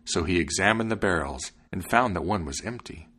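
ordinary delivery, nothing marked

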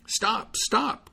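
high calls after the stork; calling out stop. A narrator's reading. Tailor calls after the striding stork, high. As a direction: call the bird to halt. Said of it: "called the tailor".

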